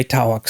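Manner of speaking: "tawak" split by a w glide; Southern diphthong. Shown as a demonstration. Confirming they do say it, Southern "tawak". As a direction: say "tawak" as two syllables, TAH-wuk. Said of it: "they do say tawak sometimes".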